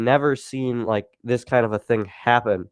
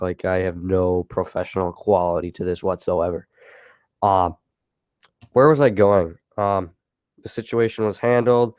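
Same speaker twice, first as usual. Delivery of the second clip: normal talking voice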